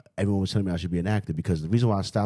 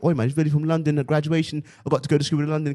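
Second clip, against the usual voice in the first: put-on higher-pitched London accent; 6 semitones higher, faster than usual. Imagining talk at graduation, London accent. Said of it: "I talked with a British accent".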